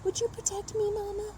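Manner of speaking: very high, cooing little voice; pet baby talk. Pretend. Scared owner asking Mama Boots for protection, high baby talk. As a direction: coo it high, coaxing a pet.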